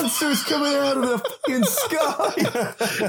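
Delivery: hammed-up shocked Santa; high singsong freakout; frazzled Santa. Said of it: "Still dressed as Santa".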